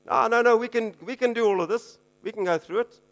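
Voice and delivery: high-pitched, insistent assurance; eager protest. Acted. Disciples assuring Jesus they can cope, eager protest.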